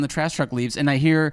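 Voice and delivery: ordinary delivery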